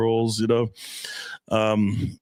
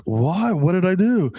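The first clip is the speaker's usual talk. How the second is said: lightly voiced girlfriend, higher pitched; puzzled girlfriend; 6 semitones higher, slower than usual. Girlfriend questioning his warning, lightly imitated bafflement. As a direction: imitate her baffled protest. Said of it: "She's like".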